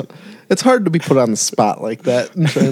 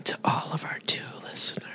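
quick ASMR whisper; 11 dB quieter, faster than usual. Pretend. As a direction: hush it like an ASMR host. Joking ASMR whisper to audience.

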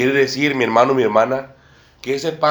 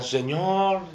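higher, imitated calling out to the Lord; blind men calling out; 6 semitones higher than usual. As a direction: voice the blind men crying for mercy. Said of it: "iban gritando también ciegos". (imitando a los ciegos que gritan, más agudo)

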